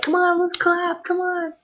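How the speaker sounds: a mild hint of a frontman calling the crowd to clap; coaxing, drawn-out crowd call; rallying the crowd. Singer urges crowd to clap.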